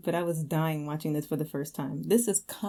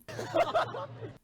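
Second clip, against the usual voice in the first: a high burst of real laughter; laughing; 4 dB quieter than usual. Real, not acted. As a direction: break into a helpless laugh. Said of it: "I can't say it without laughing".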